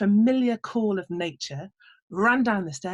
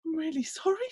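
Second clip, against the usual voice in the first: higher, softer embarrassed farmer; sheepish apology; 8 semitones higher, 4 dB quieter than usual. Acted. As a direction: mumble it like a caught-out farmer. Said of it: "said the farmer".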